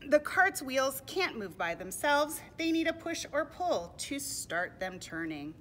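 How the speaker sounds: put-on loud, slow explanation for children; deliberate picture-book reading; storybook narration. A narrator's reading. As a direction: explain it clearly, projecting each phrase.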